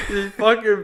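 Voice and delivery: ordinary delivery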